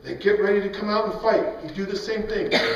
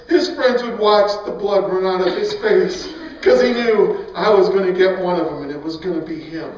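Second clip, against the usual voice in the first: ordinary delivery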